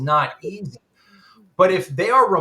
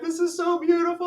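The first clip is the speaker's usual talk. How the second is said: mock bawling; faster than usual. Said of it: "I'm bawling my eyes out".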